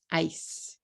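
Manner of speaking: "Ice" as one clean syllable; one-syllable English. Shown as a demonstration. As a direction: say "Ice" in one beat, ending on a bare s. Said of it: "How many syllables do you hear".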